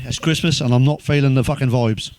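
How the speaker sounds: usual speaking voice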